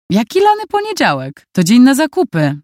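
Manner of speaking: high, incredulous scoff at the custom. Acted. Future son-in-law waves off a splash of water, incredulous. Filip dismisses the wet Monday custom.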